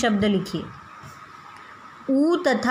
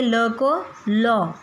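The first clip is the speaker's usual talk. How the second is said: ल को लौ spelled out very slowly; slow, deliberate classroom sounding-out; slow teaching demo; much slower than usual. Shown as a demonstration. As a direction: draw out each sound for the class. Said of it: "मतलब औ की मात्रा".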